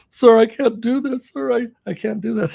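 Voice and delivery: mock teary pleading to the sir; imitating a tearful trainee. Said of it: "and she's in tears".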